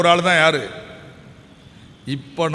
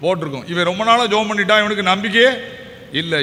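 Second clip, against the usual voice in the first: usual speaking voice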